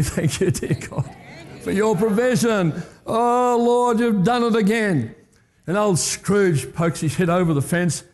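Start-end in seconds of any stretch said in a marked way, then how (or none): 0.0-5.2 congregation praise. Acted. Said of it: "Everybody say".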